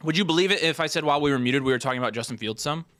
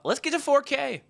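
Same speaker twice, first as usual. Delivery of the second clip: high, hyped-up push; rally the viewers, pitch way up; excited; 13 semitones higher than usual. Real, not acted. Hyping subscriber goal.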